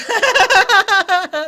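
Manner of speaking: loud, high burst of laughter; a loud, high cackle; big laugh. Real, not acted. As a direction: cackle loudly at your own joke. Laughs at his own joke.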